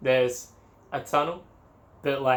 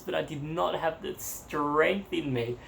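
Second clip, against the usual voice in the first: ordinary talk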